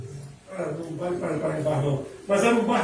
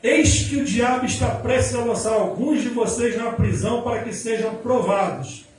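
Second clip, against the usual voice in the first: louder, emphatic preaching proclamation; forceful scripture reading; 4 dB louder than usual. Acted. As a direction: proclaim the verse to the whole congregation. Reads scripture at full force.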